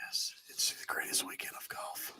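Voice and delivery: hushed golf announcer whisper. Pretend. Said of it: "I had to use my golf voice".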